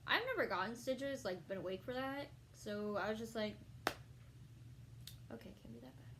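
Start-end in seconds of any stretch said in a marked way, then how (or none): 5.3-6.1 quiet. Retold or imitated. Bracing for stitches.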